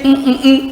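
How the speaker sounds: short, high, even hums; high hummed blank; placeholder hum. Shown as a demonstration. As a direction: hum three beats for the missing word.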